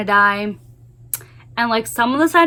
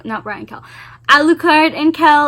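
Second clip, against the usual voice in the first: ordinary talk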